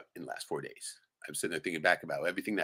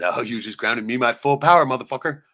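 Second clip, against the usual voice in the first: mocking shout; 6 semitones higher, 11 dB louder than usual. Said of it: "He says".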